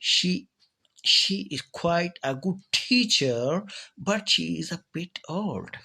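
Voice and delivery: put-on drawn-out sentence, quite falls, teacher swoops up, old drops; slowly trace the tune: down on quite, up on teacher, down on old; intonation demo. Shown as a demonstration. Teacher repeats the example, quite falling, teacher rising, old falling. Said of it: "अब quite पर आवाज़ fall हो रही है और teacher पर up".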